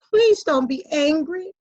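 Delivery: plain reading voice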